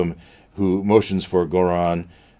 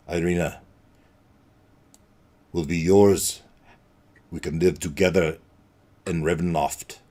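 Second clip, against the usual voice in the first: Strahd; 4 semitones lower than usual. Acted. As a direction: purr it out like a vampire lord who holds all the cards. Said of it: "Strahd says something effective".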